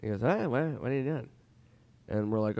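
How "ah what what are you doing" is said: lightly voiced impression of the comedian; a hint of his voice, slightly pitched up; Norm Macdonald. Norm in the lobby, lightly imitated voice. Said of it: "Norm's there, and, uh, he goes".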